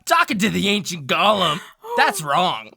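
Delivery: loud, high dramatic recap; story recap narration. Acted. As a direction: narrate the recap with grand energy.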